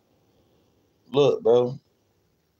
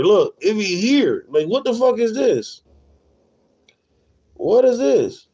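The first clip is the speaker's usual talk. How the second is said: can't believe what he's seeing; incredulous outrage; 8 semitones higher than usual. Real, not acted. Streamer to chat about his feed, outraged disbelief.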